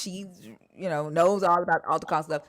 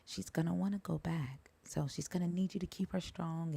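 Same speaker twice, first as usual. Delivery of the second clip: unvoiced, much softer hushed warning; imitated whisper; 13 dB quieter than usual. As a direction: whisper it close to her ear. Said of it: "start whispering in my ear".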